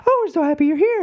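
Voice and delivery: very high-pitched, gushing princess voice; mock princess squeal of welcome; cheery Disney princess. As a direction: greet them like a relentlessly cheery princess. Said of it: "like, really cheery, high-pitched voice".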